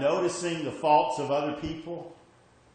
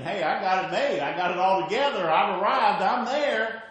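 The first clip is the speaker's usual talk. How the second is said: loud, higher-pitched self-congratulation; cocky boasting; 6 semitones higher, 9 dB louder than usual. Acted. Preacher voicing someone who thinks he's made it, loud cocky boast. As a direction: crow it loudly, building to the end.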